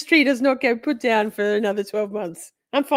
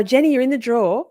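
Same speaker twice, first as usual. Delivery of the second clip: ordinary delivery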